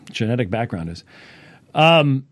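ordinary talk, nothing marked

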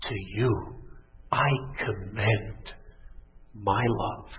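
low, soft and very measured; hushed, solemn reverence; tender divine declaration. Acted. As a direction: offer it gently, with pauses between phrases. Voices God offering love tenderly.

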